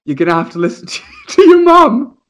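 words breaking into a high laugh. Real, not acted. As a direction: try to stay serious but crack up. Laughing while retelling advice.

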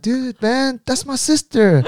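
high-pitched mock exasperation; playfully indignant, pitch way up; retold protest. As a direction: object like a teased brother. Retold protest at teasing about sister.

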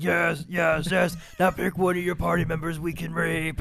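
high, eager monster cheering; delighted Fomorian boss. Retold or imitated. Fomorian boss applauding the bard's song, high and delighted. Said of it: "the four Mori's like".

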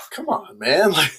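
high, fast mock-exasperated whining at a stalling star; half-laughing, hammed-up 'come on, man' pleading; exasperated plea. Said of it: "And I'm like".

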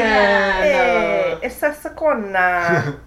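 mock Italian accent, loud; hammy Italian hey.

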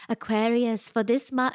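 imitating a British astrologer, slowed right down; put-on British accent, drawn out. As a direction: attempt a slow, lilting British accent. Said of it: "you know, she's British".

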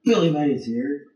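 loud, high pitchman opener; infomercial. Retold or imitated. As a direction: boom it like a TV pitchman. Imitating Billy Mays infomercial.

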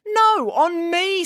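high, slow headmaster voice; a fussy headmaster correcting. A narrator's reading. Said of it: "said the headmaster".

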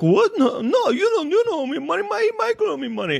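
high squeaky mocking imitation; mock woman. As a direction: pitch way up to mimic her.

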